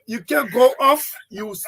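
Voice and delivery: ordinary talk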